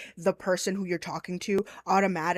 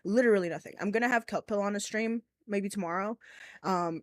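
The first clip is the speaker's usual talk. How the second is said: ordinary talk, nothing marked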